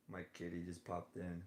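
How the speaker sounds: ordinary talk, nothing marked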